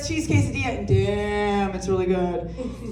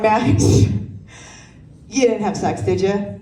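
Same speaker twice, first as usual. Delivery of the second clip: slow pointed question; 3 semitones lower, 3 dB louder, much slower than usual. Retold or imitated.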